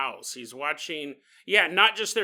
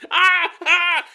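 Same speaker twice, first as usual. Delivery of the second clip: high, loud mock shriek of panic; hammed-up yelp as if bugs are on you; mock scream; 12 semitones higher, 11 dB louder than usual. Guests find stink bugs crawling on them, mock shriek.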